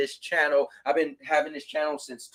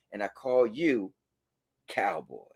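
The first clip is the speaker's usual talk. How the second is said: much lower, softer mocking repeat; mock deep echo; 9 semitones lower, 7 dB quieter than usual. Streamer teasing a chatter by repeating his line low. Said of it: "You know what, Michael?".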